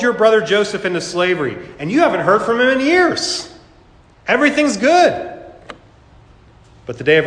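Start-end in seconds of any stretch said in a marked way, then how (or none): 1.9-5.4 much higher, breezy complacent sinner voice; smug sinner. Retold or imitated. Preacher voicing a sinner who feels safe, carefree and smug. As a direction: toss it off like nothing could go wrong.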